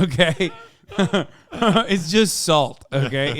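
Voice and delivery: loud, high, talking through laughter; laughing protest. Real, not acted. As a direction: laugh through an exasperated insistence. Laughing while ridiculing Vegemite.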